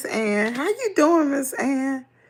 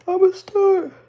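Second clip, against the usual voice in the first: yawning; 11 semitones higher, 7 dB louder than usual. Real, not acted. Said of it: "sorry to yawn on camera".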